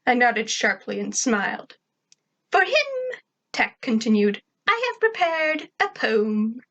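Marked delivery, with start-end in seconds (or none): 2.5-3.2 very high, put-on girl voice; high character voice; Tack. 4.7-6.6 put-on high, slow girl voice; drawn-out, high schoolgirl declaration; Tack. Tack's high character voice.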